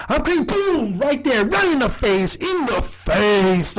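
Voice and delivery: high burst, drawn out at the end; excited re-enactment. Retold or imitated. Re-enacting Charlie's volleyball hit on D, high and excited. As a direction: start high like a sound effect, stretch the last words.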